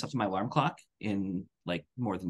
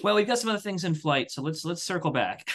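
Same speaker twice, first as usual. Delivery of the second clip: lightly higher office-speak; a hint of boss voice; mock corporate jargon; 7 semitones higher than usual. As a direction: play a manager brushing it off. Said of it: "my answer's like".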